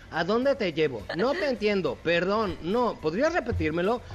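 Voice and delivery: lightly voiced virtual assistant; a hint of a polite robotic assistant; Siri voice. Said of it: "imagínate que te pongan a manejar a Siri". (voz de asistente virtual)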